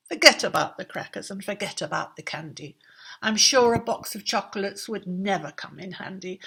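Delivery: eager rush. Acted. King's eager new wishes.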